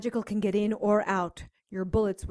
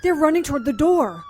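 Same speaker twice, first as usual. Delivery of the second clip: higher, louder urgent talk; urgent alarm; 5 semitones higher, 5 dB louder, faster than usual. Acted. Seeing vampires run toward the cops, urgent and alarmed. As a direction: rush to warn the cops.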